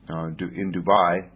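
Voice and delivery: normal talking voice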